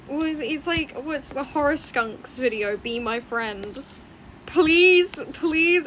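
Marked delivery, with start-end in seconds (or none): 4.4-5.9 pleading. Real, not acted. Begging viewers to watch video.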